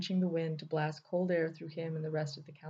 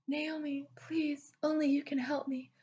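high whispered falsetto begging; mock falsetto plea; 9 semitones higher than usual. A narrator's reading. Thunder mocking Naomi with a falsetto plea. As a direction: ham up a swooning damsel plea. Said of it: "He threw his voice falsetto".

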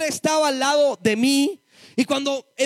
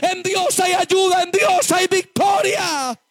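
fiery preaching; 4 semitones higher than usual. Acted. Preacher at full force warning the church against the devil. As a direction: preach it hard, rising to a triumphant shout.

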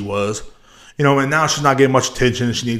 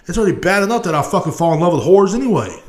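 ordinary talk

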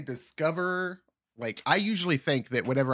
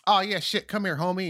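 higher, laid-back street greeting; casual homie; 7 semitones higher than usual. Retold or imitated. Reservation guy greeting a seeker casually. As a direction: welcome them like a buddy.

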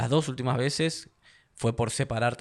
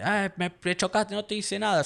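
high mock-indignant driver yelling from his car; angry driver; 10 semitones higher than usual. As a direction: play the furious driver who just got hit. Angry driver confronting him in traffic. (conductor enojado imitado, voz aguda)

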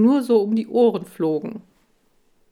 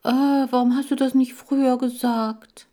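high, louder whimpering complaint; whiny; 6 semitones higher, 4 dB louder than usual. Acted. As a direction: whine like a hypochondriac. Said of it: "Laschi sank wehleidig in sich zusammen". (Laschi jammert wehleidig, höher und lauter)

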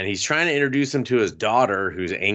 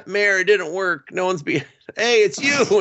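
high, laughing realization; mock surprise; 10 semitones higher than usual. Boy spotting the girl at the mayor's, high and startled. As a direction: catch yourself, startled. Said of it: "he's like".

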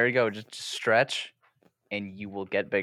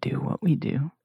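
hushed, coaxing recruitment; mock ASMR whisper; 3 semitones higher than usual. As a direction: whisper slyly, luring them into the group. ASMR parody to annoy friend.

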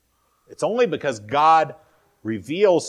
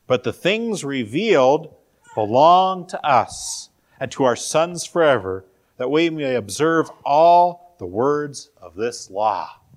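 slow, louder reading of a verse; scripture reading; 5 dB louder, slower than usual. Acted. Preacher reads Deuteronomy on what is revealed, slow emphatic scripture reading. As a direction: proclaim the verse, weighting each phrase.

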